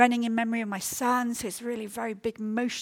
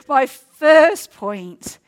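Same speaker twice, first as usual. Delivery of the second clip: "My first point" said slowly and much louder, with a laugh; emphatic repeat; 2 semitones higher, 13 dB louder, much slower than usual. Shown as a demonstration. Preacher repeating her opening point to the congregation, loud and slow. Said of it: "are you getting it?".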